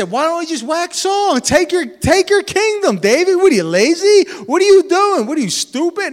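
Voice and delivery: mocking tempter. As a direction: goad him like a scornful voice in his ear. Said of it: "It's easy to say".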